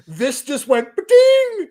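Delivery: high, slow cartoon ding; mock bell of sudden memory; ba-ding realisation. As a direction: sing out the lightbulb sound. Sudden realisation sound effect.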